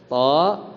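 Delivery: "To" with a measured vowel, rising; teacher's isolated "To"; demonstrated recitation. Shown as a demonstration. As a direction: hold "To" for two beats. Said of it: "Bacanya gini".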